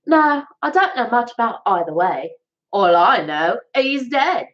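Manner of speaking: high Cockney street rat; Cockney accent. Shown as a demonstration. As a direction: play a Victorian London urchin in Cockney. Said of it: "that's my Cockney accent".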